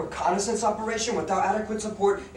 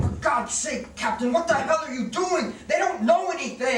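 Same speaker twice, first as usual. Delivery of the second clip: alarmed shout; 7 semitones higher than usual. Acted. Sergeant shouting at his captain to stop.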